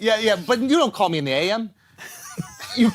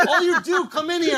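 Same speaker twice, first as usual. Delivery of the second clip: ordinary delivery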